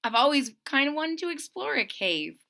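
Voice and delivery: high, loud wonder; bright-eyed discovery of a cave; excited little bear. Acted. Retelling Corduroy finding the cave, high and amazed. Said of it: "he says".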